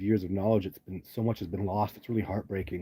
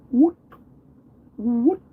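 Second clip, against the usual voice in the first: high rising "woot" calls, the second much higher and louder; young Sasquatch call; 13 semitones higher, 7 dB louder than usual. Shown as a demonstration. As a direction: mimic a young Sasquatch's rising hoot, twice. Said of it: "Let's make the sound".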